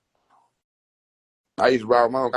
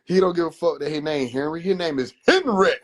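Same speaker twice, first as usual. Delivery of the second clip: normal talking voice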